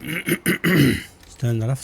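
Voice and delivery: slow, gruff grunt then words; throat-clearing grunt. Real, not acted. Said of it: "Sorry about the grunting".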